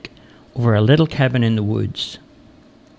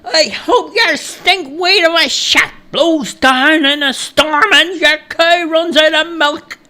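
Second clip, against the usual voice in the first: high, drawn-out yelled curse; shouting back; 4 dB louder, slower than usual. A narrator's reading. Stranger shouting back at the woodcutter's shack, shouting. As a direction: yell insults back in a high voice. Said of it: "Bill shouted back".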